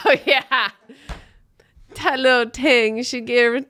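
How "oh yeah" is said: ordinary talk, nothing marked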